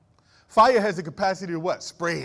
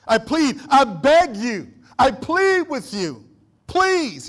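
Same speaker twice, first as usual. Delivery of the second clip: slow, loud begging plea, the last word pitched higher; pleading apostle; 6 semitones higher, 8 dB louder, much slower than usual. Retold or imitated. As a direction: beg the church like Paul, dragging the words out. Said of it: "Paul is begging".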